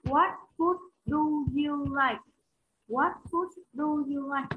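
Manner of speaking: "What food do you like?" said slowly, weight on "food"; slow model sentence. Shown as a demonstration. Teacher modelling the question slowly for the class. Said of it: "Chúng ta sẽ có cấu trúc như sau".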